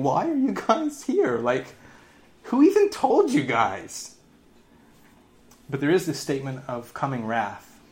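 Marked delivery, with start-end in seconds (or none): 0.0-4.2 high, slow, mocking question; incredulous mock question. John mocking the leaders who came out to him. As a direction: ask it in disbelief, pitched way up. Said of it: "It's almost like he's saying".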